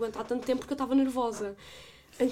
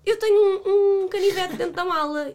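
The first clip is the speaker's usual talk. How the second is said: higher, louder, half-crying confession; mock sheepish friend; 8 semitones higher, 8 dB louder than usual. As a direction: confess it like a nervous kid. Pedro confessing to police.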